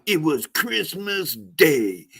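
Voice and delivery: slow, dramatic trailer narrator; movie trailer voice. Retold or imitated. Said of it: "where it's like".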